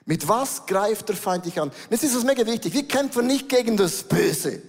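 ordinary talk, nothing marked

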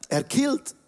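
"Erkillt" pitched high with a rising tune, like an amused question; high rising repeat. Pretend. Preacher playfully repeating his coined word, "Erkillt" high and rising. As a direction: lift "Erkillt" high, ending up in surprise.

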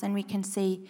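usual speaking voice

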